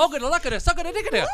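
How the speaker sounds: very fast, high-pitched gibberish; made-up language. Pretend. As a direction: race through the nonsense with laughter in it. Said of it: "we've got our own language".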